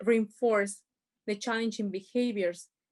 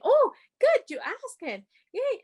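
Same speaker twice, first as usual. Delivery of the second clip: much higher and louder, gushing praise; big deal praise; 13 semitones higher, 5 dB louder than usual. Shown as a demonstration. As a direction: cheer the child on like he did something huge. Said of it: "you make a big deal".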